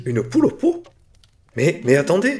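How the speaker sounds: very high, loud, shocked; stunned disbelief. Acted. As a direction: protest in disbelief. Dealer shocked by answer.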